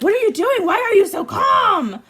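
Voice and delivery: high, loud panicked shriek; shrieking anxiety voice. Retold or imitated. Her anxiety shrieks at her, high and loud panic. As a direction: shriek it like your own panic talking. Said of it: "your anxiety is like".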